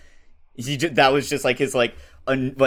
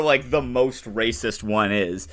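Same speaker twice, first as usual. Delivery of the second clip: normal talking voice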